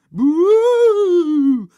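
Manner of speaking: very loud, high, long boo; shouted boo. A narrator's reading. Henry keeps heckling next door, loud booing. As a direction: boo at full volume. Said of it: "shouted Horrid Henry".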